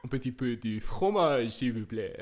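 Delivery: loud, laughing French ordering; playful French. Shown as a demonstration. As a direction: order cheese in comic French, giggling. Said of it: "French accent".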